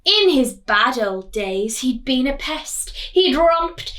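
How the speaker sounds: lively read-aloud storytelling voice; story reading. A narrator's reading. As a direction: read it like a picture book to children. Teacher reading picture book aloud.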